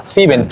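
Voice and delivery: "Sieben" said SEE-ben, first e unheard; "Sieben" with no e after the i; silent E. Shown as a demonstration. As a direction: say "Sieben" with ie as a single i. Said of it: "esa E no suena".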